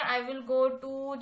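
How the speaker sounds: slow dictation. Shown as a demonstration. Teacher dictating English sentence.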